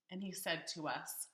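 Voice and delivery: ordinary delivery, nothing marked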